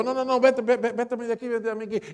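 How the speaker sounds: high, loud brush-off yell; prideful shout. Retold or imitated. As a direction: drive him away, voice shooting up. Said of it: "el orgullo del hombre trató de salirle y él le dijo". (grito orgulloso y agudo, echándolo)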